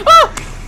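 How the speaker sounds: sudden high fright scream. Real, not acted. Player startled by the animatronic, screaming. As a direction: shriek at the jump scare. Jump scare in horror game.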